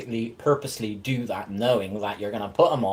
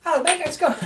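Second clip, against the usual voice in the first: excited. Retold or imitated. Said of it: "And I'm like".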